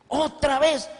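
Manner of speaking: high exasperated cry; dismayed mother. Retold or imitated. Mother finding the hearing aid broken again, high dismayed cry. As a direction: throw it up high in disbelief.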